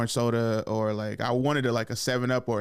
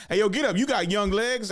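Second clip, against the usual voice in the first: higher, louder call to get up; bossy uncle; 9 semitones higher, 3 dB louder than usual. Retold or imitated. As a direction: order the kid up like a teasing uncle. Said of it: "one of my uncles was like".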